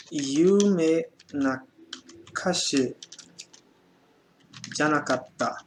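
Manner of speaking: "Yume Nakashi janakatta" stretched word by word; slow dictation. Shown as a demonstration. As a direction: dictate very slowly for students. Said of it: "E por último". (ditado muito lento)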